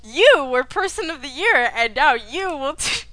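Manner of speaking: loud booming announcer. Acted. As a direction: announce it to the crowd. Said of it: "mock announcer continues".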